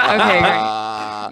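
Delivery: slow, deadpan okay great; flat sarcasm, drawn out; sarcastic. Retold or imitated. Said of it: "I was like".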